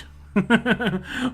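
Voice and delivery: loud, high chuckle; amused laugh. Real, not acted. Laughing at fun games.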